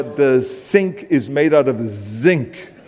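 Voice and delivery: slow "sink" against a buzzed "zinc"; accent removal drill. Acted. Actor recalling practice lines from accent removal classes, slow exaggerated diction drill. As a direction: stretch out "zinc" with a long, buzzing Z. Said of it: "even accent removal classes".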